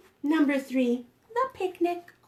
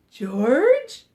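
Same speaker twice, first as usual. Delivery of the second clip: puzzled call; 7 dB louder than usual. Acted. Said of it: "It leaves us just asking".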